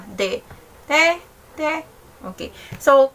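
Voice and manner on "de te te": NE said almost as DE or TE, short. Shown as a demonstration. Teacher imitates Korean drama pronunciation, NE turned into short DE. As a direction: harden the n of NE toward a d. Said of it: "nagiging parang may D sound siya".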